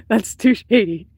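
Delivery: slow, laughing through the words. Real, not acted. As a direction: laugh out the verdict.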